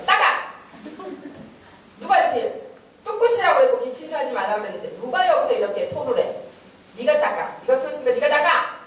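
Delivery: high, forceful telling-off; angry scolding. Retold or imitated. Teacher ordering a child to wipe up vomit, angry scolding. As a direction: rail at the child, demanding a clean-up.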